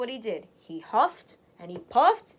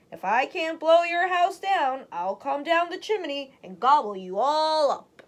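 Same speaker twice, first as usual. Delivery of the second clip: louder, higher threatening wolf bellow; wolf shouting; 4 semitones higher, 6 dB louder than usual. A narrator's reading. Wolf yells down the chimney at pigs, threatening. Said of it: "shouted down the chimney".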